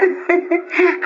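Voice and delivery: high excited giggle; giddy laugh. Acted. Woman greeting her old friend with a giddy laugh. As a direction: giggle back with delight.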